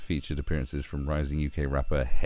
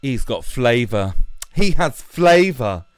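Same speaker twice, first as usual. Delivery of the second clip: higher, louder, slow gush of flavour; mock gushing woman; 7 semitones higher, 9 dB louder, slower than usual. Imitating a coworker praising Colin Farrell, slow and rising. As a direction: savour each word, building up. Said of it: "this other woman was like".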